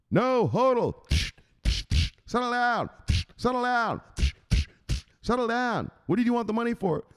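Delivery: high, worked-up mock fury; scolding boss. Boss disciplining underling who touched the Bitcoin, angry scolding. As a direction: scold between blows, pitched high.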